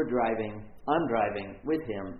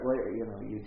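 ordinary talk

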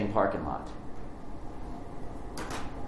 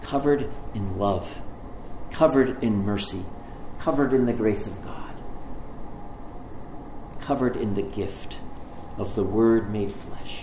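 soft, lower, unhurried blessing; gentle sermon close; 4 semitones lower, 5 dB quieter than usual. Acted. Preacher to the congregation, blessing them softly. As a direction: lay each covered down gently.

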